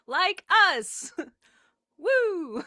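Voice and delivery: over-the-top hype cheer; excited cheer. Pretend. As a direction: cheer it out with a whoop. Enthusiastic cheer.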